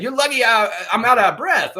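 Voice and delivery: high, loud mock quote; hammed-up repeat of a funny line; amused mock retelling. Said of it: "He's like".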